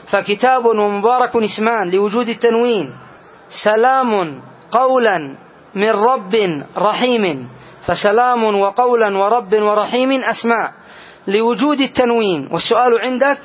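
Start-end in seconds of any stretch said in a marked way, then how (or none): 3.6-7.5 quoting a verse. Shown as a demonstration. Teacher quotes verse, very slow.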